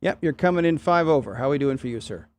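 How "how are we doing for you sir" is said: usual speaking voice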